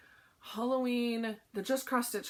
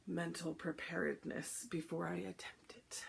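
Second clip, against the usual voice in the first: whispered aside; whispering; 3 semitones lower, 7 dB quieter than usual. Real, not acted. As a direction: drop to a whisper. Dreading the drum finish.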